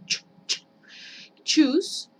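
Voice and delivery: short "ch, ch", then loud CHOOSE; cut-off "ch" demo. Shown as a demonstration. Teacher drilling the cut sound, crisp "ch" into CHOOSE. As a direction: isolate "ch", then launch CHOOSE with it. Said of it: "es un sonido que se corta".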